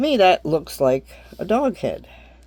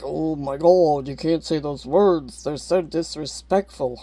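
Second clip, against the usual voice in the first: mocking prude. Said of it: "Everybody here is like".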